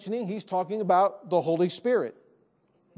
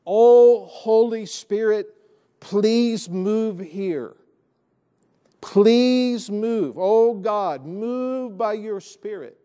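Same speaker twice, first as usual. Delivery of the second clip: slow, drawn-out mock plea, loud at first; imitation of an imploring prayer; imitating pleading prayer; 5 dB louder, much slower than usual. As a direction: beg like someone praying for a stuck God to move. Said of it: "it sounds like they believe the Holy Spirit's stuck".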